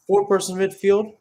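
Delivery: ordinary delivery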